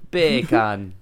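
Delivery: "Bacon" in a loud mock Jamaican accent, second vowel open as "kahn". Shown as a demonstration. Host demonstrating the sound-alike, "Bacon" in mock Jamaican accent. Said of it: "bacon in a Jamaican accent".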